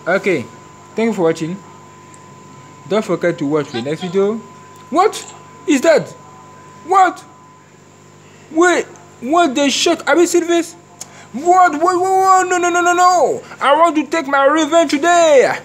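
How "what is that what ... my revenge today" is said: very high, loud disbelieving cries; shocked yelling. Real, not acted. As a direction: shriek in disbelief at what happened. Shocked outburst in game.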